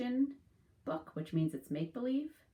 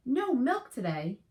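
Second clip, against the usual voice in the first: dismayed cry; 7 semitones higher, 7 dB louder, slower than usual. Acted. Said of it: "cried Farmer Brown".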